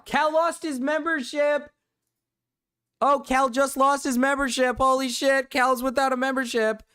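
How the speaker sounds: cackling at the news that Cal lost his membership; excited disbelief. Real, not acted. Gleeful reaction to chat news.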